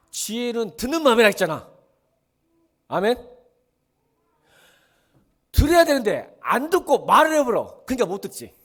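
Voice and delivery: 높고 아주 큰 소리로 외치기; 답답한 듯 목청껏 꾸짖는 설교; 격앙된 설교. Real, not acted. Preacher shouting key point. (목청 높여 호통치듯)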